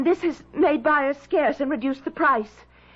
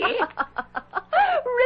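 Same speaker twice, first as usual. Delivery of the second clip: dismissive laugh; 9 semitones higher than usual. Acted. Laughs off Richard's ghost claim.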